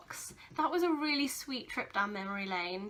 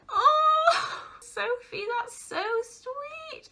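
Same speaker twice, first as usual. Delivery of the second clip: high, drawn-out tearful coo; touched, tearful; 12 semitones higher, 6 dB louder, much slower than usual. Real, not acted. Sister moved by her childhood gift book, tearful and touched. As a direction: melt into a high-pitched, emotional squeal, stretching the last words. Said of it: "I've got tears in my eyes".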